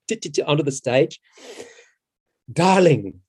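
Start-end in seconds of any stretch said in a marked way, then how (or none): 2.5-3.2 theatrical producer. Retold or imitated. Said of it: "said Cameron".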